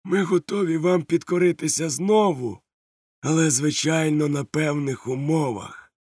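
high, smooth pirate voice; sly bargaining pirate. Acted. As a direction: bargain with oily charm. Silver bargains with captain.

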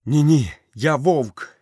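high, loud protest; boy's desperate loud protest; shouting. Acted. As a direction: cry out in denial. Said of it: "закричав Мауглі".

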